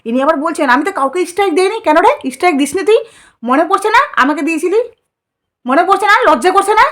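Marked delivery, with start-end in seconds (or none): 0.7-1.8 put-on high, quick childish lisp; sneering baby-voice imitation; mock baby talk. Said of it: "এত আদো আদো করে কথা". 1.9-6.9 high, indignant telling-off; rising angry reproach; angry scolding. Real, not acted. Scolding the woman directly.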